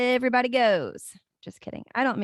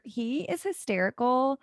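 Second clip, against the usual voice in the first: ordinary talk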